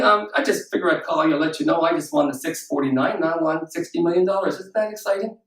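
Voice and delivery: low, mock matter-of-fact; underwhelmed phone call. Lottery winner calmly phoning a friend with the news, underwhelmed. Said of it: "Is that truly what you think would happen?".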